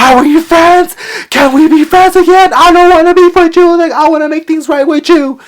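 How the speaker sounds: very high, loud, whiny mock plea; mocking whiny stalker. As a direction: squeal it like a needy pest begging. Stalker pleading, mocked high voice.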